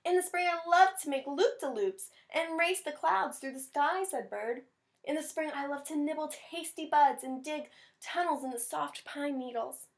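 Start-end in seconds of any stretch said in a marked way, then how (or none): 0.0-4.0 higher, bubbly bird voice; excited Bird. Acted. Teacher voicing joyful Bird for children, higher. Bird describes spring joys. 5.0-9.9 gentle Mouse. Acted. Teacher voicing sweet Mouse to the class, higher.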